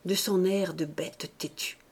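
usual speaking voice

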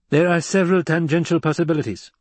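precise, high-voiced scheming counsellor; analytical mentat. Voices Piter the mentat advising.

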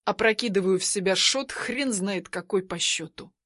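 ordinary delivery

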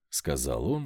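ordinary delivery, nothing marked

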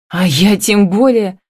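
a touch of heated outcry; heated cry. A narrator's reading. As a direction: exclaim it indignantly, without a full shout. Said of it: "в запале выкрикнула Камила".

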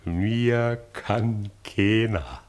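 very slow, stretched-out boast; triumphant drawl. Acted. Franz boasting that nobody can touch him, drawling. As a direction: draw every word out long, relishing it.

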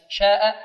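long four-count alif in شاء; connected madd. Shown as a demonstration. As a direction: hold شاء out before its hamza. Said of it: "مد واجب متصل، أربع حركات". (مد شاء أربع حركات)

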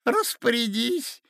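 put-on very high, quavering old man; excited old prince. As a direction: babble it out, aghast. Old prince telling accident.

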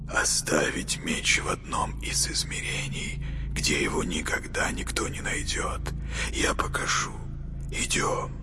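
hushed, hissing whisper; breathy whisper of an uneasy boy. A narrator's reading.

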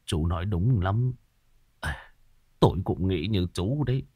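put-on low, soft old voice, halting; tearful resignation. A narrator's reading. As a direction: confide sadly after wiping away tears. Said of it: "Lão Cải lau nước mắt".

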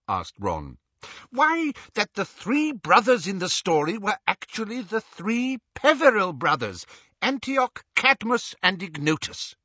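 high, pedantic eccentric wizard; Xenophilius voice. A narrator's reading. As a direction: lecture like a dotty scholar.

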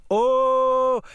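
long, very high sustained exclamation; thrilled cry. A narrator's reading. Girl at the top of the swing's arc, thrilled cry. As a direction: hold a high cry of exhilaration. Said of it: "на самом верху кричит".

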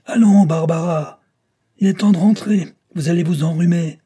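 high, loud calling out; stentorian voice. A narrator's reading. Judge calling his daughter in from the window, stentorian voice. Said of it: "fit entendre sa voix de stentor".

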